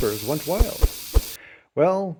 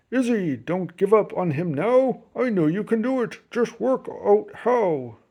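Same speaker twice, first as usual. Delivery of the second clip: lightly voiced grandpa; 4 semitones higher than usual. A narrator's reading. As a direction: urge her on gently, drawing out the last words. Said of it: "Grandpa said".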